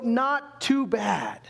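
"not too bad" stretched very slowly; drawn-out not too bad. Shown as a demonstration. Preacher mocking the prairie reply, very slow. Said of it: "No, so".

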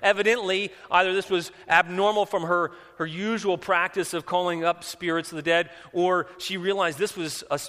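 high, loud, driving sermon delivery; full-force preaching. Real, not acted. Preacher retelling the woman's warning to Saul, full-force preaching. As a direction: preach it loud and high, building to the last word.

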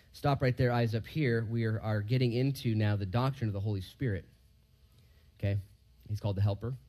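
ordinary delivery

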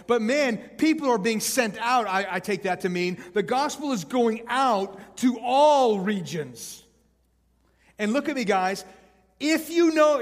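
loud, rising sermon climax; preaching at full force. Acted. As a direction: drive the point home to the crowd.

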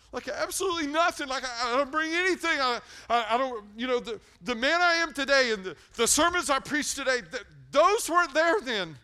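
high, loud, pounding confession; fired-up sermon peak; full-force preaching. Real, not acted.

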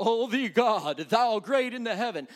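hammed-up, lofty churchy praying; pious formal prayer. Preacher mimics another person's sinner's prayer, mock-reverent and grand. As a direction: put on someone else's grand prayer voice. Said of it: "That's not the way I talk".

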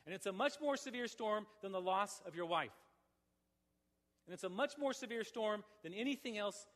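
loud, rising hellfire sermon voice; preacher driving the congregation toward God's wrath, loud preaching; full-force preaching. Acted. Preacher warning the room of judgment, loud and pressing.

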